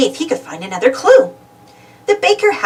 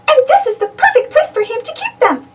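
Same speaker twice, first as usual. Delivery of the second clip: high, sweet character voice; pleased Minnie. A narrator's reading. Minnie telling Daisy about Donald's gift, high voice.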